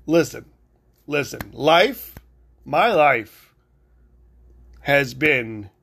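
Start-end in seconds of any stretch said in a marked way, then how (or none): none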